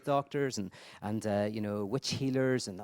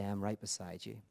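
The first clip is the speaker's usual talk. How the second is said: soft, quiet and tender; whisper-close comfort at a bedside; a pastor's quiet promise of presence; hushed reassurance; 5 semitones lower, 8 dB quieter than usual. Shown as a demonstration. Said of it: "and say".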